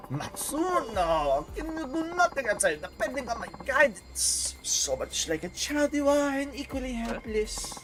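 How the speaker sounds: high French diva, scolding; haughty mock-French complaint; questionable French accent. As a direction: chide a servant in a hammy French accent. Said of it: "do my questionable French accent".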